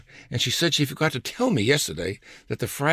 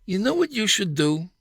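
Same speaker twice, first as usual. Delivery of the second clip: Lucy's bossy advice; 8 semitones higher than usual. Retold or imitated. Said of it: "Her suggestions began".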